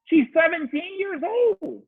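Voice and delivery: very high, drawn-out insistence; emphatic excusing. Real, not acted. Dad defending his teenage daughter, high and emphatic. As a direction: stretch it out high in exasperated emphasis.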